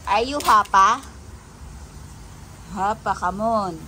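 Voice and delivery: loud, rising call of the pet's name; a loud coaxing call to a pet. Real, not acted. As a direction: call the pet loudly across the room. Calling the pet louder.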